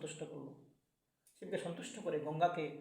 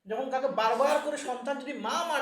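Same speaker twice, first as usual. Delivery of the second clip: fervent emphasis; 6 semitones higher, 7 dB louder than usual. A narrator's reading. Teller stresses repeated calling.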